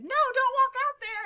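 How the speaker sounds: high, loud burst of warning; alarmed plea. Retold or imitated. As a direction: act out an urgent protest. Retold plea to dad not to go.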